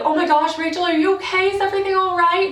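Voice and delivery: high-pitched, fussing worried guest; imitated concern. Wedding guests checking on a red-eyed Rachel, mock alarmed concern. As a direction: play a guest rushing in with anxious questions. Voicing worried guests who saw her crying.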